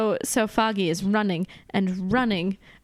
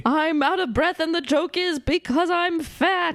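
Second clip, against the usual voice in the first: raised, mocking imitation; mock Foggy; 6 semitones higher than usual. Co-host sending up the movie's fat joke, mocking. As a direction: pitch it up to mock a breathless sidekick.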